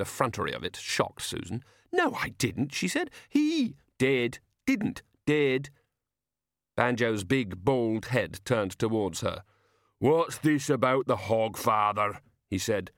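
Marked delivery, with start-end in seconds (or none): 1.9-5.7 playground squabble. A narrator's reading. Playground squabble voiced in story. 10.0-12.2 slow, lumbering character voice; big simple man, doggedly questioning; slow-witted giant. A narrator's reading. Slow giant Banjo questions Susan.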